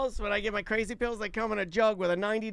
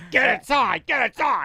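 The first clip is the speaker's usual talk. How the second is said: mock shout; 3 semitones higher, 6 dB louder than usual. Mock officer herding people in.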